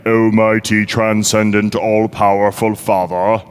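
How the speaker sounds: slow, stately lofty prayer voice; formal distant address. Shown as a demonstration. Pastor mocking a distant lofty prayer to God. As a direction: intone it grandly, from far off. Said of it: "we don't pray".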